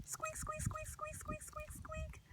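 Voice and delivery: high, soft squeaky mouse sounds; mouse squeaks. Shown as a demonstration. Librarian showing kids a mouse's squeak, high and soft. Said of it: "What sound does a little mouse make?".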